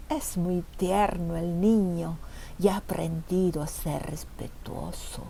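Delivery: slow, drawn-out sinister taunting; gloating jailer. A narrator's reading. Jailer gloats over the captured boy, slow low menace.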